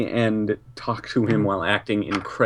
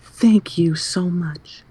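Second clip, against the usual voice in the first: put-on high, slow gushing girl voice; cute anime girl; 7 semitones higher, slower than usual.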